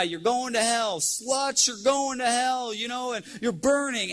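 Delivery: hammed-up street preacher rant; mock yelling preacher. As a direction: mimic him condemning passersby. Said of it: "he was yelling at these girls".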